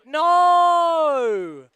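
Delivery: high, loud cry of dismay; dismayed shout. Real, not acted. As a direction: yell as the rider falls. Rider falls.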